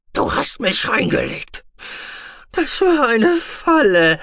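high, loud unhinged ranting; raving madman. Acted. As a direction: rant like a man gone mad. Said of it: "Er kicherte wie irre".

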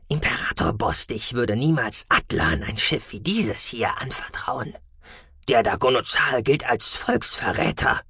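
leicht verstellt, nachdenklich; Freundstimme. Acted. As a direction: ein Offizier teilt seinen Verdacht. Freund Damalan gesprochen. (leicht verstellte, nachdenkliche Freundstimme)

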